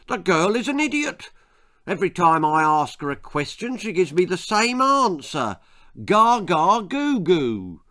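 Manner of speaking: high, loud frustrated rant. A narrator's reading. Amos rants to Betty about the baby, high and loud. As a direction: rant in fury. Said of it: "he ranted".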